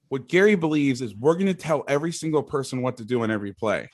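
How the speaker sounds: normal talking voice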